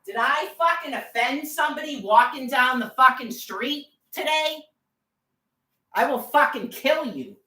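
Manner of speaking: high-pitched, real outrage; angry outburst. Real, not acted. Woman confronting whoever bothered her walking down the street, furious. Raging at harassers while out walking.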